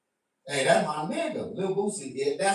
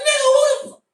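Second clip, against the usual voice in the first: outraged shout; 8 dB louder, faster than usual. Retold or imitated. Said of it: "I'm like".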